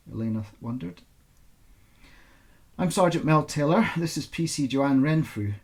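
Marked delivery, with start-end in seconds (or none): 2.8-5.5 louder, firm police sergeant. A narrator's reading. Sergeant greeting Elena in the interview room, firm and official. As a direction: official introduction, firm and clear. Sergeant introduces herself formally.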